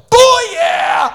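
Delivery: a loud joyful whoop; excited shout. Acted. Isaac shouting with joy at the ram. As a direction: yell it out in triumph. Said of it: "Isaac was like".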